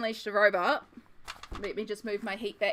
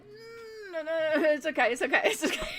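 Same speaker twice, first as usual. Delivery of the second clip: flustered refusal; 9 semitones higher than usual. Retold or imitated. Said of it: "I'm gonna be like".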